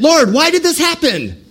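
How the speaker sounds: high pleading cry to heaven; crying out to God. Acted. Israelites crying out to the Lord, high pleading wail. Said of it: "they're crying out to Lord".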